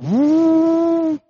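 long loud high whoop for the engine; imitated engine roar. Tractor roaring back to life.

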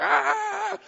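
high loud falsetto scream; mock scream. Preacher acting out the disciples screaming, loud mock scream. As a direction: shriek like grown men terrified. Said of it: "they're just screaming".

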